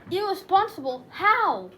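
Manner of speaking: sharp, loud questioning; indignant disbelief. Acted. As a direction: throw the accusation back, affronted. Jack objects to the accusation.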